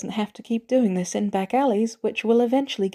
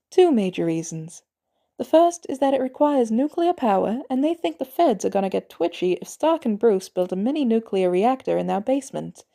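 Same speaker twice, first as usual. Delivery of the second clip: ordinary delivery, nothing marked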